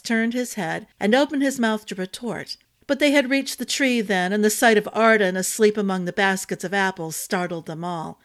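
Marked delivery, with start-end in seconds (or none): none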